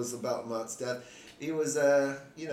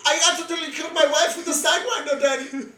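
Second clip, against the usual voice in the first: very high, loud cartoon voice; high comic character on the edge of cracking up; hammed-up put-on character; 13 semitones higher, 8 dB louder than usual. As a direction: squeal it in a mock accent, half laughing. Joins the mock foreign-donor bit.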